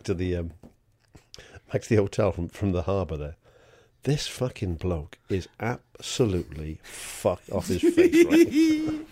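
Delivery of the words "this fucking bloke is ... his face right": building excitement. Real, not acted. Excited building to drunk story.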